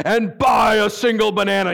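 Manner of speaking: loud, thundering command you feel in your chest; booming angel voice. Acted. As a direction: hammer out the order from heaven.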